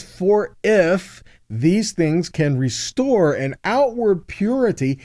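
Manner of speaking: ordinary delivery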